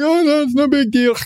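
high, dopey idiot voice. Retold or imitated. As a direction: play a clueless fool brushing it off. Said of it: "my default idiot voice".

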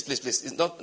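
ordinary talk, nothing marked

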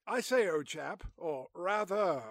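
hammed-up British old-chap voice; mock British. Mock British alternative title.

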